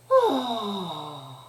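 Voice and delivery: long "ahh" sigh, falling in pitch; exaggerated sigh of release; fun sighing sound. Shown as a demonstration. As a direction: breathe out on a voiced sigh. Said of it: "practice doing that with a fun sound".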